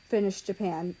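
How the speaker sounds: ordinary delivery, nothing marked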